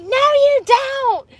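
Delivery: high, drawn-out screech; imitated scream. Girlfriend contradicting her antagonizing man, mock screaming. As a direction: screech it slowly like a furious girlfriend. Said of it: "She screamed the first time".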